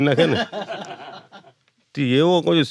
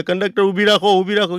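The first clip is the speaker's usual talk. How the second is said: high raised call to stop the bus; retold shout; 6 semitones higher, 3 dB louder than usual. Passenger calling to conductor to stop, raised shout. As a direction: yell up to the conductor to halt. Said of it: "એક ભાઈએ રાડ પાડી".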